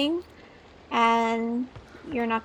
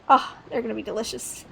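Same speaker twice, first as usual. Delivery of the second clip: usual speaking voice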